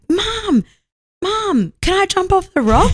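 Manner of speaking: put-on high child calling for mum; little girl voice. Daughter asking mum to jump, imitated high child voice. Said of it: "Kelsey's little girl was like".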